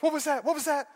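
high panicky mock girlfriend; scared girlfriend. Scared girlfriend in a horror movie, mock panicked. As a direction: squeak it out like a frightened girlfriend. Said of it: "The scared girlfriend goes".

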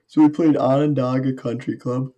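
talking through a yawn. Real, not acted. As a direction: yawn while saying it. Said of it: "Excuse me while I yawn".

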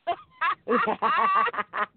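a bright, high burst of laughter; real laugh. Real, not acted. Remembering the friend's comeback, a real laugh. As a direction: laugh out loud, caught off guard. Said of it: "I fell out laughing".

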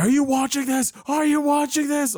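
high-pitched, frantic imitation; urgent, high mock excitement; excited friend. Retells friend's excited FaceTime call.

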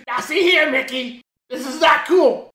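indignant cartoon telling-off, played for laughs; scolding cartoon character. Acting cartoon character scolding Mickey.

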